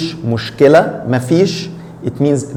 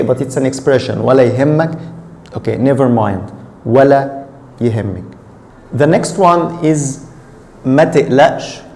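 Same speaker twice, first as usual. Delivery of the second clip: usual speaking voice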